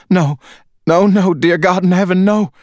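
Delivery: a wife refusing the news of her husband's death; anguished wife. A narrator's reading. Wife begs the officers, high and anguished, for it not to be true.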